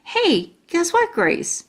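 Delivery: high, eager burst; like a brand new idea just hit; excited. Acted. Junie B telling Grace her idea, excited. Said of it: "I said, very excited".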